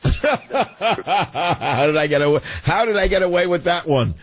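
chuckling through the words; amused, laughing. Real, not acted. Host laughs at own line.

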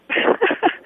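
light high-pitched chuckle; real short laugh at your own joke. Real, not acted. Caller laughing after a joke to the radio host, amused laugh.

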